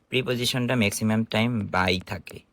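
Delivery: usual speaking voice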